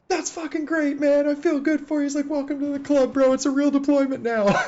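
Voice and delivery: high, elated mock yell; hollered congratulations. Gunner congratulates the medic across trucks, high mock holler.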